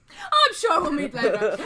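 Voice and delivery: slow, tearful, hammed-up farewell; mock-weepy merchant voice; dramatic old shopkeeper; flamboyant old dude.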